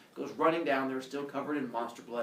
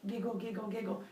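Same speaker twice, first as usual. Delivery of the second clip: soft, higher-pitched spoken giggling; mock giggle; 8 semitones higher, 6 dB quieter than usual. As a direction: lightly imitate a sneaky giggler. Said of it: "Hera giggles and walks away".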